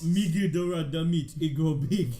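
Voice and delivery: caveman voice. Pretend. Caveman mockery, loud.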